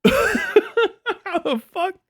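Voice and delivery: high, very slow drawn-out disbelief; gasping shock, stretched out; stunned gasp. Retold or imitated. Retold reaction to washcloth claim, stunned and drawn out. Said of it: "I, like, I was".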